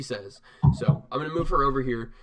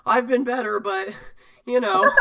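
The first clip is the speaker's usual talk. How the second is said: put-on high, loud, hesitant boy voice; winded youngster faltering in a high pitch; Morty; 8 semitones higher, 8 dB louder than usual. Small knight answering whether he's all right, high Morty-like voice. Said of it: "why do you sound like Morty".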